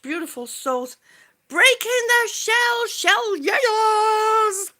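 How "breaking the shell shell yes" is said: high, loud rallying shout; rally everyone with a cheer; excited cheer. Real, not acted. Cheering a viewer's catchphrase.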